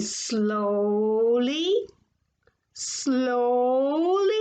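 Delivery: drawn-out, stretched vowels; very slow. A narrator's reading. Narrator showing the peddler's slow walk, very drawn out. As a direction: stretch "slowly" out as slowly as it means.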